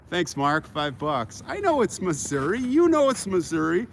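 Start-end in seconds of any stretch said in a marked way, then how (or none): 1.5-3.9 mock insistence; playful teasing. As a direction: rub it in playfully. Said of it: "And I'm having fun".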